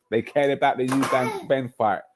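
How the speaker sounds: usual speaking voice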